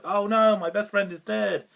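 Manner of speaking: mock dramatic despair; over the top dramatic. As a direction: overplay the grief like a bad soap hero. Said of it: "Like, really dramatic, over the top".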